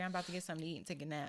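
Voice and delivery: fast, soft brush-off. Retold or imitated. Dad texts back to dodge her, fast and soft. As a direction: hurry through it, soft and dismissive. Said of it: "He's like".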